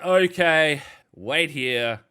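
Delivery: loud, strained-patience okay; exasperated compliance. Acted. Seller giving in to fussy TV buyers, exasperated.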